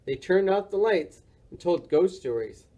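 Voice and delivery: plain reading voice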